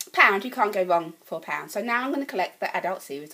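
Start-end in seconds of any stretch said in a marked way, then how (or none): none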